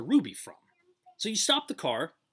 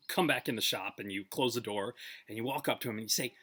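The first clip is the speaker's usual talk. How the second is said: ordinary delivery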